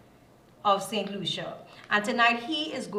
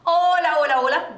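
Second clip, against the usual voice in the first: loud, bright-pitched hellos to open the segment; excited greeting; 5 semitones higher, 9 dB louder than usual. Real, not acted. Host greets viewers back after the break, excited and loud. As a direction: call out the hellos, welcoming everyone back.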